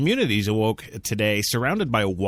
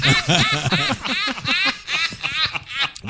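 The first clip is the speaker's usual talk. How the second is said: high-pitched burst of laughter; high laughing fit; 3 dB louder than usual. Real, not acted. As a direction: break into high giggles. Laughing fit mid news read.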